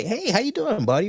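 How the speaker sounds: high-pitched, chummy retail hello; friendly greeting. Retold or imitated. Retail worker patting a customer's back, friendly retold greeting. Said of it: "I'd pat you on the back and I'd say".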